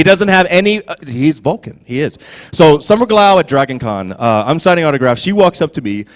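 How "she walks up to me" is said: ordinary delivery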